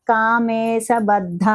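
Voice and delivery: కామేశబద్ధ said very slowly with a heavy ధ; slow careful recitation. Shown as a demonstration. Teacher modelling the name for students, slow. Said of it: "చక్కగా".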